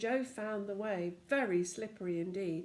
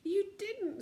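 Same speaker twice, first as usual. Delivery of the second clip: mild sob; 12 semitones higher than usual. Acted. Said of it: "sobbed Fanny".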